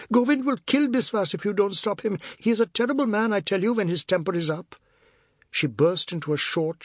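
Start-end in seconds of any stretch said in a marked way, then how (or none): 0.1-4.8 high, urgent pleading; agitated high-pitched appeal; alarmed cry. A narrator's reading. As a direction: warn them in a panicked high voice. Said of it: "Chinta cried".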